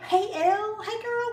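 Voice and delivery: very high, squealed hello; real high-pitched bubbly greeting; excited greeting. Real, not acted. Greets friend joining the live.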